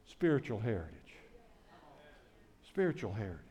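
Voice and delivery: quiet, reverent restatement; hushed emphasis. Real, not acted. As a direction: let it sink in, almost under the breath. Preacher repeats the theme word.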